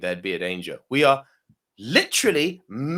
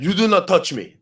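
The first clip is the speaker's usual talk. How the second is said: louder imitating an angry man; aggressive; 7 semitones higher, 7 dB louder than usual. Aggressive gym renter warning the speaker off, imitated loud. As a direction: bark it back like the guy squaring up. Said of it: "then he got really aggressive with me".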